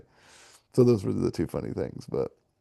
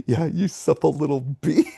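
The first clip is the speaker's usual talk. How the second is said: higher-pitched mock coaching, "bee" hit firmly; forceful on BEE; 7 semitones higher than usual. Imitating instructor to dog, "bee" said with mock force. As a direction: land hard on the final word "bee". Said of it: "using the B word so forcefully".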